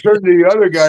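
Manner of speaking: ordinary talk, nothing marked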